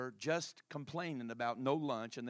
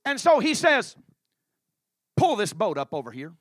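full-force preaching; 7 semitones higher, 16 dB louder than usual. Acted.

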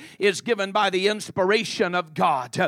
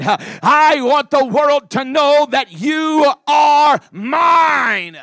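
high, loud preaching building to a long shouted climax; fervent preaching; 4 semitones higher, 6 dB louder than usual. Acted. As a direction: preach at full force, punching each phrase. Preaches at full force.

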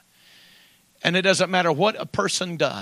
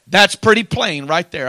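ordinary talk, nothing marked

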